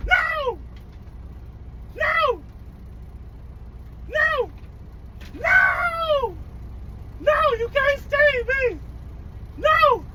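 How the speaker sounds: high panicked screams of no; screaming at a spider. Real, not acted. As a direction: shriek no in real fear. Screaming as bug survives.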